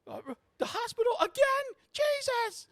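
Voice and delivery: fast, loud falsetto; Abby losing it. Acted. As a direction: shriek in high exasperation. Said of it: "like, literally losing it".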